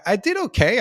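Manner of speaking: I did okay thrown up high with pride; proud surprise. Real, not acted. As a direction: burst out that I did okay, pleased. Proud turn in the story.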